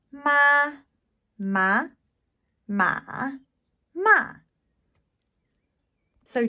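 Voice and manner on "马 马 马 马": "马" high flat, rising, dipping, then falling; four tones of ma. Shown as a demonstration. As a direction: run "马" through all four tones. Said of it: "what these might sound like".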